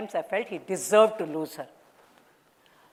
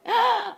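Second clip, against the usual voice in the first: sharp high gasp of delight; a sudden happy gasp; 14 semitones higher than usual. Acted. Mother hears of pregnancy.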